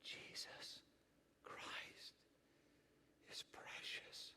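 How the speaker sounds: faint breathy whisper, slow; barely a whisper, like a frail old man. Retold or imitated. Frail old preacher whispering to his church. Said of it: "said these words again".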